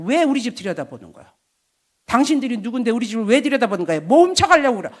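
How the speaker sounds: put-on angry old man shout. Father-in-law accusing villagers of theft, building angry yell. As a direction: accuse the passers-by, getting angrier. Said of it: "하고 소리 지르고".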